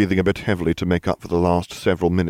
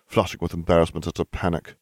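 ordinary delivery

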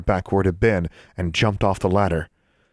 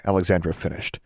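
plain reading voice

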